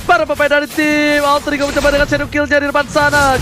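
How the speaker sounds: ordinary delivery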